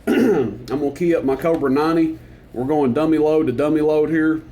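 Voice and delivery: normal talking voice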